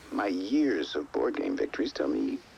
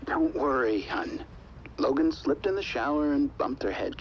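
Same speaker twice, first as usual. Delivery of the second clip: ordinary talk, nothing marked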